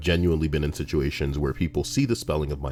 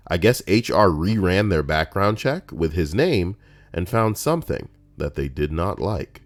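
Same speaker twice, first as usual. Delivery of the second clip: ordinary talk, nothing marked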